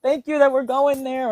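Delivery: giving thanks. Acted. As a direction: give thanks with happy anticipation. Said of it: "give thanks".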